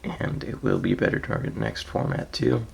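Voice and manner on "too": ordinary talk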